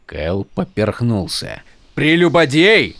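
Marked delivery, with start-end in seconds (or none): 1.9-3.0 startled echo; shocked soldier. A narrator's reading.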